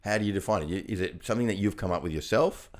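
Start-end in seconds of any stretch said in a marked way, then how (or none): none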